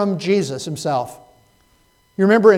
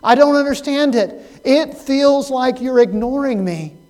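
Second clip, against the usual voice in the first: crying out to God; 6 semitones higher, 4 dB louder than usual. Retold or imitated. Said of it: "we cry out".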